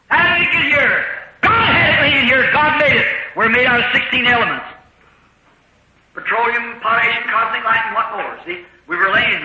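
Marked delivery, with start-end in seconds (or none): 0.1-3.1 preacher's shout. Acted. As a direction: call it out with conviction.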